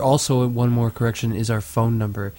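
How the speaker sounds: ordinary delivery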